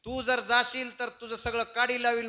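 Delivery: high, loud threat; quarrelling threat. Acted. Wife threatens husband in quarrel.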